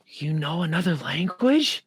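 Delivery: gasp, then slow awed question; mock amazement. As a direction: act exaggeratedly astonished. Acts out people's amazed reaction.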